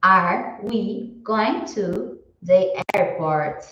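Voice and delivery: slow, careful English question; deliberate teacher read; slow model question. Shown as a demonstration. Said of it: "se fosse uma interrogação".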